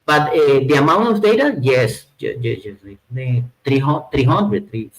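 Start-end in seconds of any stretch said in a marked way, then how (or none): none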